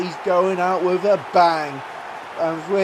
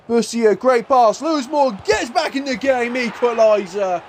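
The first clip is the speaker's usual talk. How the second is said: higher-pitched equaliser call; excited goal call; 5 semitones higher than usual. Real, not acted. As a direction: celebrate the goal as live commentary. Calls an equalising goal live.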